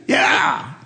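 very high, short parrot squawks. Acted. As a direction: summon him like a bossy talking parrot. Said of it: "There's the old parrot".

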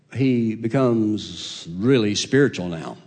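normal talking voice

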